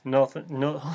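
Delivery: normal talking voice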